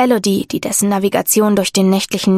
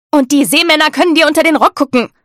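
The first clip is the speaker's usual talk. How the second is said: fussy mother scolding; 7 semitones higher, 5 dB louder than usual. A narrator's reading. Mother adds a scolding worry.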